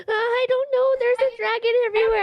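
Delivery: very high, wailing panicked character voice; squeaky acted panic, stretching into a cry; terrified. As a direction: wail in hammed-up fear and confusion. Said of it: "she's kind of terrified".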